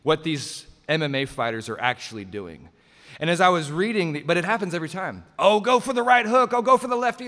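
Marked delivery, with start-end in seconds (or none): 5.4-7.2 mock know-it-all fan yelling tips, higher and louder; backseat fight fan. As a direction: ham up a loud armchair expert shouting at the screen. Mocking armchair fighting critic.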